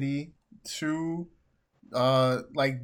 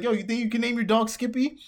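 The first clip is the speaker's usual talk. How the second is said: mock jerk; 9 semitones higher, 4 dB louder, faster than usual. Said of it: "He's like".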